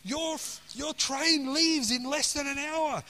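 high, slowed voice of a travel agent; acting out an agent's phone announcement. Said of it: "It was the travel agent saying".

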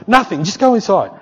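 high, loud irritated brush-off. Retold or imitated. Husband fending off his wife's question, irritated. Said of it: "be irritated with her".

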